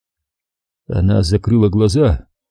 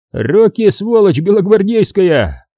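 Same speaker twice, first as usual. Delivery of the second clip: грубый окрик солдата; 9 semitones higher than usual. A narrator's reading. Красноармейцы кричат.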